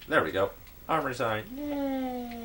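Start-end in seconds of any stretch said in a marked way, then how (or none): 1.6-2.5 high squeaky yay; excited cheer. Real, not acted. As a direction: cheer it out happily. Cheering at finished sign.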